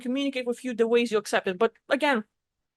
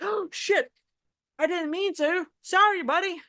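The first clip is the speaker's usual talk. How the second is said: higher-pitched, hastily backpedaling apology; lightly acted oops; mock apologetic; 5 semitones higher than usual. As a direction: play a being sorry for the scare. Voices being that scared someone.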